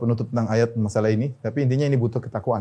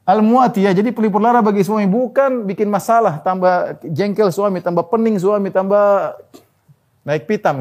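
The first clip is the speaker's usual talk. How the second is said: raised, high insistent sermon voice; fervent preaching; 7 semitones higher, 4 dB louder than usual. Acted. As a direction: drive the point home to the women. Preaching at full force.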